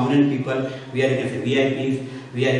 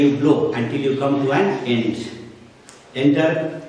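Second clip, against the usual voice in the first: ordinary talk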